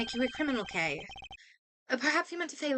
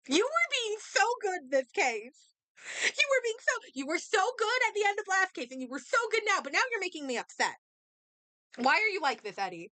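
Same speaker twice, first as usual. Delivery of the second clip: higher, whiny exasperated plea; higher exasperated pleading; 6 semitones higher than usual. Real, not acted. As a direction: plead with the character, pitched up. Pleading with Eddie in game.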